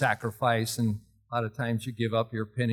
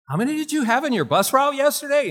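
loud, high-pitched public question; loud teacher; 13 semitones higher, 5 dB louder than usual. Retold or imitated. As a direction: imitate a teacher asking loudly for all to hear. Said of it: "she liked to talk loud".

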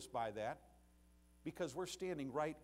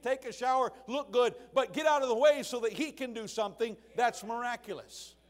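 high, loud, fervent preaching; preaching at full force; 8 semitones higher, 13 dB louder than usual. Acted. Preacher stirring his congregation with a soul-winning story, full-force preaching. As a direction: drive the story home from the pulpit.

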